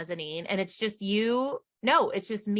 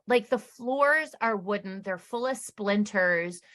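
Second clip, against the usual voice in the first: ordinary talk, nothing marked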